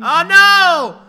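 high, loud wail of dismay; playful "doomed" yell; mock dismay. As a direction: cry out in hammed-up horror. Reacts to falling asleep in game.

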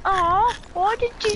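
aggrieved, high-pitched moan; whiny complaint. Real, not acted. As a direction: whine in protest at being picked off. Complains about being sniped.

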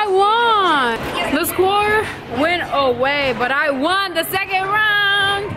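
bragging to the camera about winning; excited gloating. Real, not acted. Celebrating beating her at bowling.

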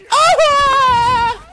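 long, high-pitched scream; loud scream. Real, not acted. Player screaming mid-game, long loud scream. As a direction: let out a drawn-out, piercing yell. Sudden scream during play.

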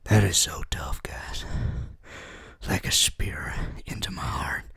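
hammed-up hurt, barely voiced; mock heartbroken whisper. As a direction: whisper like a spear just hit you. Reacting to painful quiz sign.

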